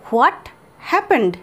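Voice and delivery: a pause between what and happened; split into words. Shown as a demonstration. Teacher showing students each word separately. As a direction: break it into two clear words. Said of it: "এটাকে আমরা এভাবে বলতে পারি".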